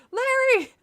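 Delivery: high, loud scream of a name. Acted. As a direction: scream for him across the water. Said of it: "I screamed".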